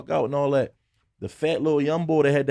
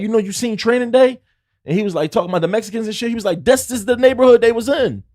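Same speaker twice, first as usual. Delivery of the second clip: cop voice; 10 semitones higher, 7 dB louder than usual. Retold or imitated.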